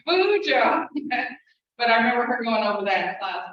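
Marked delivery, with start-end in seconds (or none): 0.1-0.9 Bujo said higher and louder, a laughing correction; mock teacher correction. Imitating the teacher correcting someone, higher and louder. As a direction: Bujo tossed out as a teasing correction. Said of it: "she'll just like".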